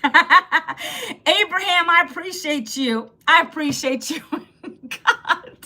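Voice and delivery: real laughter breaking into thanks; cracked up, stretching the name out through laughs; laughing. Real, not acted. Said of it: "I just need the laugh today".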